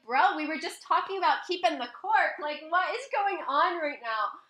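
incredulous protest. Retold or imitated. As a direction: protest in disbelief, slowing on the last question. Retells own protest to date.

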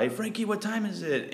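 high-pitched, held-back mock yell; imitated yell. Grandma calling to the kid in the house, mock yell. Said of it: "the grandma yells out".

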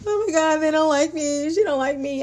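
mild mock whine without crying; insecure whining. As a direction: parody the insecure girl, a touch of a whine. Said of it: "I would never say that again".

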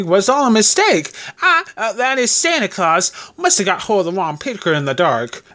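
high, flustered, stumbling excuse; landlady breaking out. A narrator's reading. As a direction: explain it away in a high voice, faltering. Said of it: "broke out landlady".

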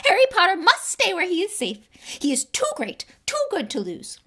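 high, loud, desperate elf; high house-elf voice. A narrator's reading. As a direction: beg him to stay safe.

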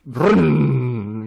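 loud revving engine noise; engine starting up. Acted. Comic telling of getting fired up, loud engine imitation. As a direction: imitate an engine roaring to life. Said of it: "みたいなエンジンがかかり始めて".

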